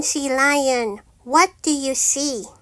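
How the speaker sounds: ordinary delivery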